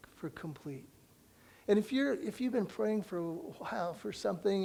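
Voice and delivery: ordinary delivery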